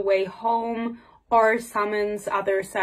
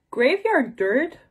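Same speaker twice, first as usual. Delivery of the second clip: slow, higher, rising disbelief; incredulous question; 5 semitones higher, much slower than usual. Shown as a demonstration. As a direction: question the phrase as if you can't believe it. Repeats the phrase in disbelief.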